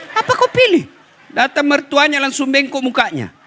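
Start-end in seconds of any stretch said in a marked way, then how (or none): 0.1-0.8 mock demand. Mocking wife's demand.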